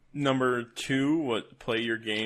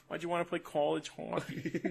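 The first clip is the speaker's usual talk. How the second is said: put-on a half-laughing try at a Boston accent, pitched higher; Boston accent attempt; 6 semitones higher, 3 dB quieter than usual. Podcaster tries the quote in a Boston accent. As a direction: friend reads the coach's line in a Boston accent. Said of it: "It's very close, actually".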